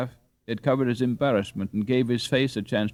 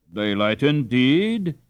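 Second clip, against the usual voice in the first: slow, louder mocking echo; scoffing echo; 4 semitones higher, 5 dB louder, slower than usual. Father dismissing mother's plan, slow mocking echo. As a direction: throw her word back at her. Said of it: "echoed Ralph".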